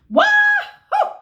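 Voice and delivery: wild high whoop; yell. A narrator's reading. Cowhand alone in the winter night, whooping loud. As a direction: let the whoop fly out over the snow. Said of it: "he yells".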